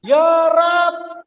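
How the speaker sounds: seruan Ya Rab yang tinggi; teriak meniru jamaah. Retold or imitated. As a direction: lempar Ya Rab ke atas seperti jeritan. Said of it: "sambil teriak". (jamaah di belakang berteriak saat doa, seruan tinggi)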